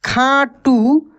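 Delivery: खाटू said slowly with a lifted, long टू; slow stressed word. Shown as a demonstration. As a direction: draw out खाटू for the class. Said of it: "ऐसे ही लिखाएगा".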